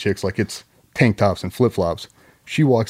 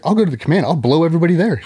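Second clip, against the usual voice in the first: mock worked-up wife; 7 semitones higher, faster than usual. Wife's threats rising.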